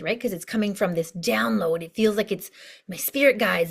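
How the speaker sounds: ordinary talk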